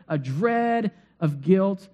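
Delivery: ordinary talk, nothing marked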